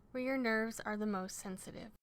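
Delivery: usual speaking voice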